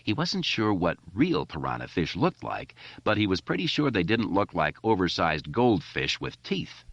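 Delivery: plain reading voice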